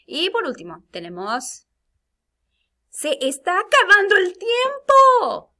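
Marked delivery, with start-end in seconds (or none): 2.9-5.5 very high, loud, drawn-out cry of urgency; urgent, intense exclamation. Shown as a demonstration. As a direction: shout it anxiously as time runs out. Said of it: "Con emoción o intensidad". (exclamación intensa y urgente)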